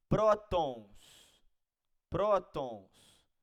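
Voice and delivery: prótons with PRÓ loud and drawn out; stress on first syllable. Shown as a demonstration. Teacher showing students an example, stressed PRÓ in prótons. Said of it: "é uma paroxítona".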